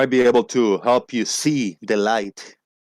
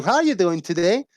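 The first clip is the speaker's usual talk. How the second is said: high, sing-song telemarketer imitation; scam-call recording; 9 semitones higher than usual. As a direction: mimic a perky automated sales call. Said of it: "they ask me a question".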